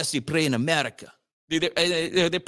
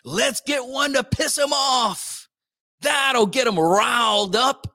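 higher, slow, sly scheming voice; mock scheming editor; 6 semitones higher, slower than usual. Host mimics editors plotting clickbait, sly and high. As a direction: play the sneaky editor plotting outrage. Mocking headline writers' scheming.

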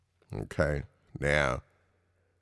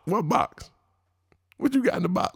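high, baffled questioning; pitched-up mock confusion; mock bafflement; 13 semitones higher than usual. Real, not acted. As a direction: ask as if truly lost.

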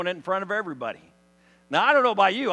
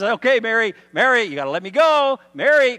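louder, mock-pleading insistence; insistent urging; 4 semitones higher, 9 dB louder than usual. Jesus asks clinging Mary to let go, loudly insistent. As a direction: press her firmly to loosen her grip.

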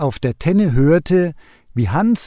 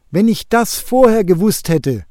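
higher, louder outcry of regret; exclaiming; 6 semitones higher, 4 dB louder than usual. A narrator's reading. Eagle calling out his regret to himself. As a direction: cry out in dismay. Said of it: "rief er aus".

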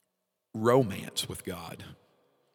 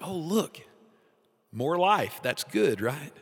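high, bright mock excitement; thrilled at new shoots, pitched up; eager delight; 6 semitones higher, 4 dB louder, slower than usual. Believer delighted by new growth, pitched-up excitement. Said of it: "You're like".

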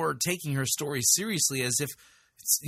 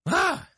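mock yelp; 7 dB louder than usual. Mock shock at the story.

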